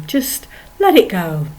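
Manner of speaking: ordinary talk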